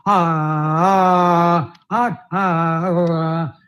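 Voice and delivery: low held "ah" on one level note; sustained steady "ah". Shown as a demonstration. Voice teacher showing students how to hold a decibel, steady sustained "ah". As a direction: hold the vowel without wavering up or down. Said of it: "you should not go down or up".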